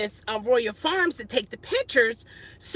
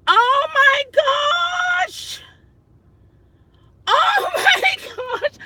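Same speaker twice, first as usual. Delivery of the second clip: high, stretched-out shriek breaking into a laugh; real mortified laughing squeal; 12 semitones higher, 3 dB louder, much slower than usual. Real, not acted. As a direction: squeal it through embarrassed laughter. Still reeling from the typo.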